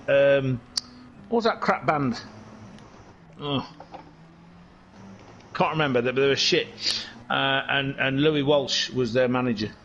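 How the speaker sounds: ordinary talk, nothing marked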